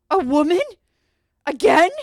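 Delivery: loud, high mock outrage; indignant guys. Guys turn to see mom walk in, loud indignation. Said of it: "they all turn. They're like".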